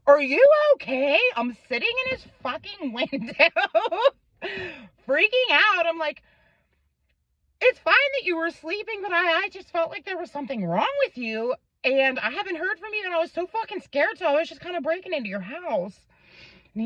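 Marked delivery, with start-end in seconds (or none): 0.1-1.2 anxious call. Retold or imitated. Calling out, freaking out. 7.6-16.0 fast and much higher, flustered; tumbling out the words in a nervous rush; rushed nervous explaining. Retold or imitated. Girlfriend explaining to boyfriend why she broke in, rushed and flustered.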